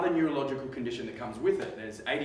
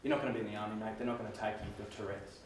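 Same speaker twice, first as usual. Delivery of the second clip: lightly voiced mum, lower and softer; a hint of a gently firm mother letting him down; Mum's voice; 6 semitones lower, 8 dB quieter, faster than usual. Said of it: "She goes".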